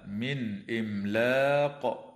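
من إملاق with the noon sounded plainly before the glottal stop, slow; no nasal blend between من and إملاق; tajweed demo of izhar; clear noon. Shown as a demonstration. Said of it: "وتظهر النون الساكنة عند الهمزة".

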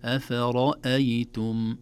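أفرأيتم slow, all light except a heavy ra; correct أفرأيتم. Shown as a demonstration. Teacher modelling light letters around the heavy ra. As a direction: stretch أفرأيتم with only the ra heavy. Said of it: "هكذا".

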